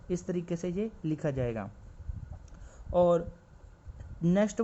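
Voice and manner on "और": ordinary talk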